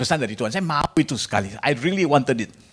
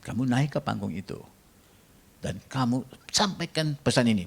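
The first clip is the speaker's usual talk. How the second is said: lightly voiced God, softer and hushed; 6 dB quieter than usual. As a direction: speak as God, quietly commanding. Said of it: "suara Tuhan". (suara Tuhan yang lembut dan pelan)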